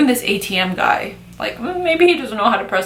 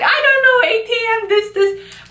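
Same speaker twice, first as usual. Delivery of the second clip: much higher, squeaky, helpless voice, trailing off; flustered dad; 13 semitones higher than usual. Retold or imitated. Dad defends using the bank teller, high and flustered. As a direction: play the dad fumbling for an excuse.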